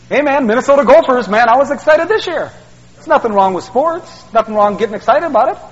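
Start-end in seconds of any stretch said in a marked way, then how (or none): none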